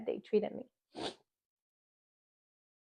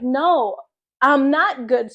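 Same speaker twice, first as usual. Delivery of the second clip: louder, raised pitch, insisting she's not fine; retold loud protest; 3 semitones higher, 7 dB louder, slower than usual. A patient contradicting the anesthesiologist, loud and urgent. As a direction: push back hard, you're in pain. Arguing with anesthesiologist mid-surgery.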